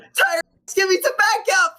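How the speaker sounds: high-pitched mock shout, no real volume; calling out. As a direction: call for backup in a squeaky pretend yell. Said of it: "Just shouts out".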